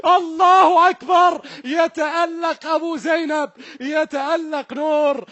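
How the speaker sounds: loud, high shouted celebration; screaming joy after the penalty save; euphoric save call. Real, not acted. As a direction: roar it out, the keeper has saved it. Keeper saves, commentator erupts.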